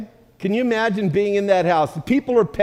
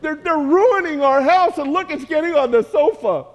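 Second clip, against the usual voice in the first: upset housewife; 9 semitones higher than usual. Retold or imitated. Woman of the house to Moshe, high and laughing alarm. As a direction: wail at the damage to her house. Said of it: "the woman in the house is going".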